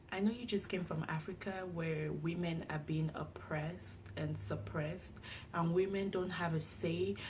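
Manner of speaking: soft imitation of an American teacher's accent; hushed American-accented teacher. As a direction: say it quietly in a put-on American accent. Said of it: "let me do it in her accent".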